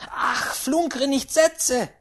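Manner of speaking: loud, high, drawn-out rebuke; irritated great-aunt. Acted. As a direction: brush the warning off crossly. Great-aunt brushes off warning.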